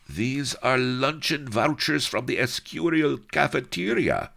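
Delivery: high, baffled exclamation; bewildered cry. A narrator's reading. Enchilada reading the false papers, high bewildered cry. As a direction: cry out in confusion. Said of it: "he cried in bewilderment".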